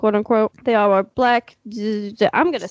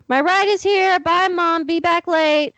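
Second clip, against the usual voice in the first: higher-pitched goodbye called through the house; calling out; 7 semitones higher than usual. Acted. Daughter tells Mom her ride is here, calling out. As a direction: call out a hurried farewell.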